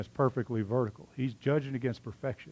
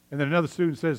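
ordinary talk, nothing marked

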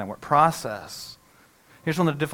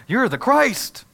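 excited exclamation; 9 semitones higher, 7 dB louder, much slower than usual. Retold or imitated. Peter's confession voiced in sermon.

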